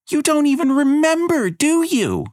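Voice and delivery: put-on high, loud disbelieving girl; appalled girl. Abigail accuses Elena of forgetting, high and loud. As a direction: hurl the accusation.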